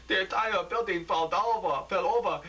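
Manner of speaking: high, loud crying voice. Acted. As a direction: sob the words out. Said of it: "Dave crying".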